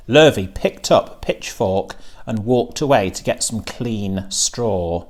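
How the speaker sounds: plain reading voice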